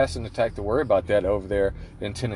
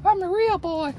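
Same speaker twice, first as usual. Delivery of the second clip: put-on much higher, slow puppet boy voice. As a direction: squeak it out like a puppet come alive.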